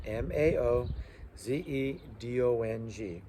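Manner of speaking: MAO ZEDONG letter by letter; spelling out letters. Shown as a demonstration. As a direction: spell MAO ZEDONG for note-taking students. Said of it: "and that's in Pinyin".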